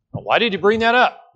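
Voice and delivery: normal talking voice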